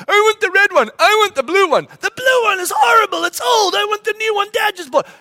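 put-on high, loud shouted kid bickering; squabbling kids. Kids fighting over which surfboard is whose, shouted whining.

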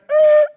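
very high, loud screech; piercing imitated squeal; mimicked screech. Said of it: "So it's like".

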